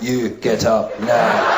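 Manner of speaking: low, very slow command; menacing mock hard-man order; tough first aider. First-aid guy ordering the patient up, low tough voice. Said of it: "like the toughest first aid guy".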